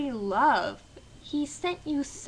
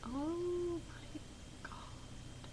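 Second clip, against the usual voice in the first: soft breathy gasp, much quieter and higher; shocked gasp; 6 semitones higher, 13 dB quieter, much slower than usual. Retold or imitated. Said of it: "Heather's like".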